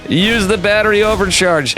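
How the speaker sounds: high-pitched, urging call; practically begging the player; urgent excited plea. Real, not acted.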